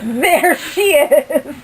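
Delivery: loud, slow laughing call; bursting with laughter; laughing greeting. Real, not acted. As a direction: laugh it out to a friend across the room.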